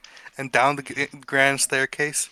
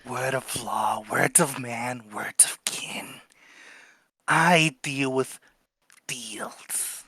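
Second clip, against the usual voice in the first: ominous whisper; 4 dB quieter, slower than usual. Acted. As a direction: whisper it like a riddle.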